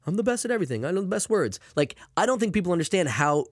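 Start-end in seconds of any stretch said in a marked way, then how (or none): 0.1-1.6 higher, boastful politician impression; Donald Trump. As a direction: brag like a pompous president. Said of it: "You know, like Trump".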